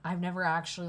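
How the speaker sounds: ordinary delivery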